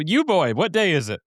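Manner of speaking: put-on hamming up Scrooge shouting to the boy below; Scrooge at the window. Retelling the film to his co-host, a light Scrooge voice.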